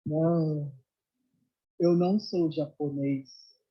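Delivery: low, slow, unruffled reply; Meixo Sama. Retold or imitated. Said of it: "o que que ele disse?".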